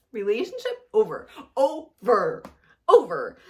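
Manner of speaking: firm final repeats, rising volume; emphatic. Real, not acted. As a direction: slam the door on it, building. Vows to end the relationship.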